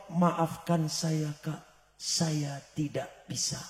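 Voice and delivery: slow, soft, low tearful refusal; quietly crying sister saying she can't. Retold or imitated. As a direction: weep out the apology. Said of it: "sambil berlinang air mata, dia nangis".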